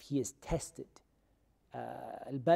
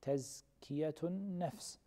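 each syllable of Tazkiyatun nafs drawn out slowly and softly; slow model answer; 8 dB quieter, much slower than usual. Shown as a demonstration. Teacher gives students the term, slowly and softly. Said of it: "What do we call it?".

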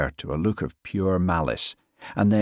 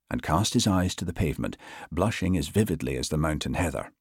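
usual speaking voice

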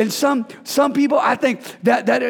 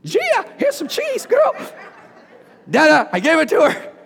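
put-on much higher child's voice; a high, proud little kid handing over cheese; little boy voice; 7 semitones higher than usual. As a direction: squeak it out like a small son. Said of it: "He's like".

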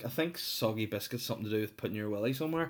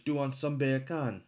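sing-song mock Welsh accent; 4 semitones higher than usual. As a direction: slide from Jamaican into full Welsh lilt. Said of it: "I sound fully Welsh".

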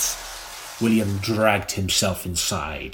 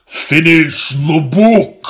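slow, loud, lower demand; deeper; 4 semitones higher, 7 dB louder, much slower than usual. Acted. Old woman demands again on request, slow loud deeper command. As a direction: growl the order out slowly. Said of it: "deeper. Oh, deeper".

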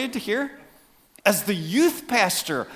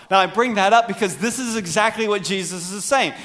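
ordinary talk, nothing marked

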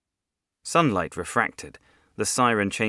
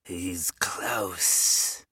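ghoul whisper; 3 dB quieter than usual. Acted. Ghoul whispers in dark story.

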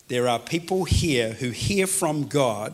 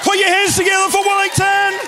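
much higher, much louder announcer call; rallying shout; 11 dB louder than usual. Real, not acted. Preacher asking the church to applaud Wellington guests: big rallying shout. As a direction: whip the crowd into applause.

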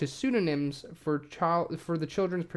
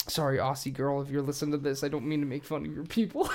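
usual speaking voice

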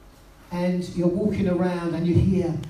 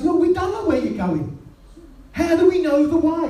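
high, pleading disciple voice, a bit louder at the end; doubting Thomas; 10 semitones higher, 3 dB louder than usual. Retold or imitated. Disciple asking Jesus, lost and anxious, in a high pleading voice. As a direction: voice Thomas as a baffled, anxious follower. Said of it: "But Thomas goes".

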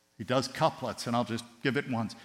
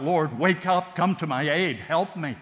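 louder, higher plea to God; pleading cry; 5 semitones higher, 6 dB louder than usual. Retold or imitated. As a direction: cry out for help like the psalmist. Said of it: "you cried out".

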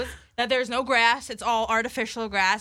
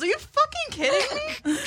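high, laughing disbelief; mock disbelief. Friend retelling her reaction to eyeball advice, high incredulous laugh. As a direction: squeak it out in total disbelief. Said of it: "I'm like".

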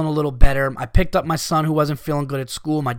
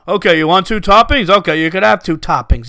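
louder put-on dad voice; indulgent dad impression; 5 dB louder than usual. Annoyed customer mimicking a dad allowing two toppings, put-on voice. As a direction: imitate a dad giving in on toppings.

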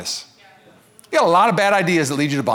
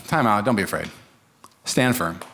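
calm Moses; 6 semitones lower, 3 dB quieter than usual. Retold or imitated. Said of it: "And Moses is like".

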